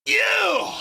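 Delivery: real hype yell; excited shout. Real, not acted. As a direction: shout it at the page. Hyped reaction.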